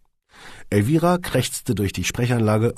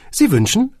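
higher and loud, brisk; Elvira's voice; 8 dB louder than usual. A narrator's reading. Secretary answers the intercom, high and brisk.

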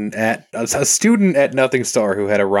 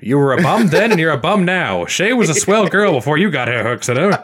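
hard-boiled PI, higher and louder; tough guy fifties voice; 7 semitones higher, 4 dB louder than usual. Acted. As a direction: snap it like a radio gumshoe. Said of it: "a tough guy nineteen fifties voice".

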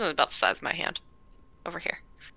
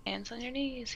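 kindergarten teacher voice; 5 semitones higher, 12 dB quieter than usual. Pretend.

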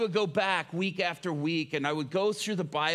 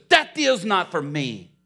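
loud, slow, punched-out refusal; defiant refusal; 3 semitones higher, 12 dB louder, slower than usual. Retold or imitated. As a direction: throw it back hard, each word heavy. Prisoner rejecting the offer, shoving away.

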